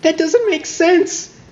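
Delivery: slow, high, drawn-out disbelief; incredulous. Real, not acted. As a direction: stretch it out like you can't believe it. Mocking the game's logic.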